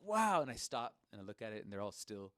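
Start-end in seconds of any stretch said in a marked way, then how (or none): 0.0-0.4 high, louder mock-astonished exclamation; retold amazement. Player seeing clock numbers dance, amazed. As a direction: act out being wowed.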